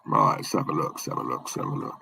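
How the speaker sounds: quick whispered mutter to yourself; muttering to self. Real, not acted. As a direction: mumble under your breath while looking. Muttering to self while searching.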